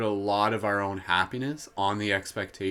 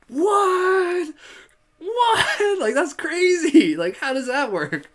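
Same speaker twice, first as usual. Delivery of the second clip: squealing high, loud "what?" of disbelief; mock amazement; 9 dB louder, much slower than usual. Host mocks amazement at her own superhero joke, squealing. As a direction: play it as over-the-top astonishment.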